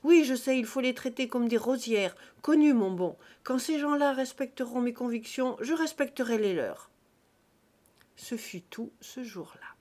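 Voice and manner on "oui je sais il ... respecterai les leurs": loud, cutting retort; a brash guest shouting down his host; loud interruption. Acted. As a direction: talk right over him. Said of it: "Joseph Mouradour l'interrompit".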